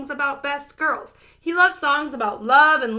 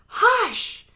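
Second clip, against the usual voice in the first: a sharp, higher hush; scolding hush; 9 semitones higher than usual. A narrator's reading. Daddy scolding Holler at the concert, sharp hush. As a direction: tell him off in one word. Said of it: "Daddy scolded".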